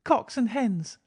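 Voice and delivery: put-on a high, loud, delighted child's shout; gleeful cry. A narrator's reading. Girl points at buzzards and cries out, gleeful. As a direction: cry out with joy at the birds. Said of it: "cried the little girl gleefully".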